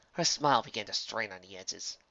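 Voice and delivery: ordinary delivery, nothing marked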